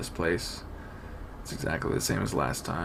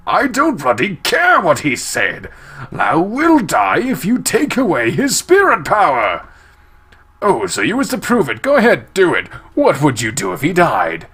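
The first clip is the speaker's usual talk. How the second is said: loud, higher angry shouting; furious scolding; 7 semitones higher, 12 dB louder than usual. Acted. Girl berating Likulau about Lao, furious shout. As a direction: tear into him, outraged.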